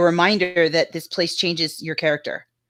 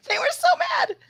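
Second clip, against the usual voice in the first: laughing. Real, not acted. Gleeful retelling, pitch jumps high.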